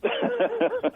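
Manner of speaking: high giggle; overjoyed. Real, not acted. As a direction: giggle at her own joke. Caller giggles again.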